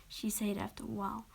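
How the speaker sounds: usual speaking voice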